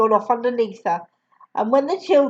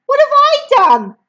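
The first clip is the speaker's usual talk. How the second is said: put-on much higher, louder child's question; startled child; 14 semitones higher, 7 dB louder than usual. Matilda answering the headmistress, high and startled. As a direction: sound caught off guard.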